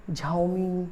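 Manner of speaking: Xiaomi with a J, "jhao-mi"; common mispronunciation. Shown as a demonstration. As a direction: say Xiaomi as "JAO-mee". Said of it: "और न जाने क्या क्या बोलते थे".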